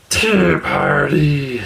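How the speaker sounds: slow, satisfied troll murmur; troll content at the tea table. Acted. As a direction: savour it like a happy sigh. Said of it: "said the troll contentedly".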